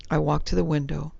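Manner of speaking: ordinary delivery, nothing marked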